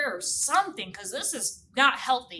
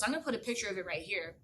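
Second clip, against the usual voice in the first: usual speaking voice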